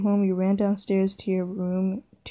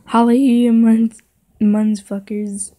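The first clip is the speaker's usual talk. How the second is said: loud greeting; 3 semitones higher, 8 dB louder than usual. Acted. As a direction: burst in hollering to everyone. Character bursts in greeting everyone.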